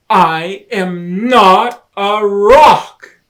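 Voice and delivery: slow, loud, odd voice from the rock; Hermit Crab's voice. Acted. Hermit Crab corrects the friends, slow loud odd voice. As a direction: speak up slow as the surprised rock.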